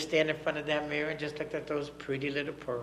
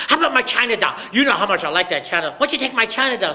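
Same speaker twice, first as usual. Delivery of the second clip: put-on high, loud child bargaining; bargaining little girl; 7 semitones higher, 10 dB louder, faster than usual. Little girl offers daddy her china doll, high and loud. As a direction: offer the doll instead, pleading. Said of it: "She said".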